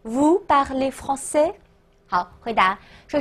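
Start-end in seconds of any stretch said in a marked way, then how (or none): none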